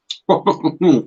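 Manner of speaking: high strained laugh through heat; pained laugh. Real, not acted. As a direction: laugh it off wincing. Laughs at the burn.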